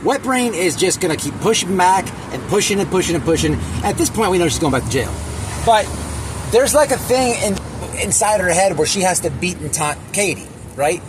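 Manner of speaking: normal talking voice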